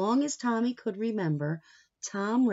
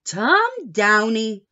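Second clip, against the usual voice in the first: scolding grandma; 3 semitones higher, 10 dB louder, slower than usual. Retold or imitated. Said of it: "Nana would say".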